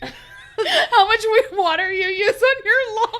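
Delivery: laughing through the words; giggling while talking. Real, not acted. Mocking the water talk.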